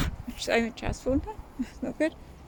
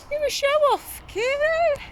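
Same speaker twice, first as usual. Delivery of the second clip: high sing-song cooing at a cat. Real, not acted. Mum coos at her cat showing off on the roof, high baby talk.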